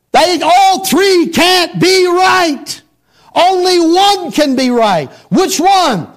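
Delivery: slow, high-pitched pulpit insistence; forceful preaching. Real, not acted. Preacher challenging three clashing Bible versions, forceful preaching. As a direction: drive each word home to the congregation.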